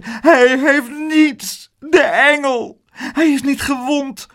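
high, drawn-out tearful wail; sobbing relief. A narrator's reading. Aunt hugging her unhurt child, high sobbing wail. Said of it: "en snikte".

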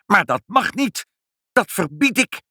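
high-pitched outraged protest; furious cry. A narrator's reading. Pinkepank forbidding the cats' digging, high furious protest. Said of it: "riep meneer Pinkepank woedend".

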